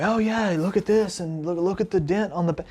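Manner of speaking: high-pitched, hammed-up eager outburst; mock blowing up. New guy to the manager about the dent, mock excited outburst. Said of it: "blowing up about this thing".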